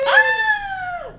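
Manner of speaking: high loud fake gasp of amazement; mock gasp. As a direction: gasp like the trick stunned you. Fake amazement at vanished toothpick.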